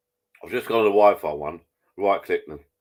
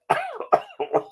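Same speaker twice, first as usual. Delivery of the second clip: hacking cough. Real, not acted. Coughing fit before choking.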